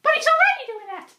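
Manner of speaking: high, exasperated protest; exasperated Catwoman. Acted. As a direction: whine it high. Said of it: "Catwoman is like".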